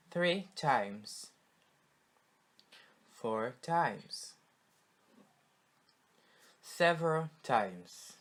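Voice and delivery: loud, clear words for the class to echo; loud drill model. Shown as a demonstration. Teacher calling out words for the class to repeat, loud and clear. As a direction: call each word out for students to repeat. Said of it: "Let's repeat them one more time".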